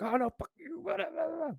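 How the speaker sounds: very high mock jabber; angry crowd imitation.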